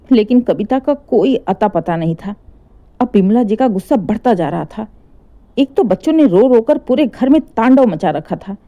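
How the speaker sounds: ordinary delivery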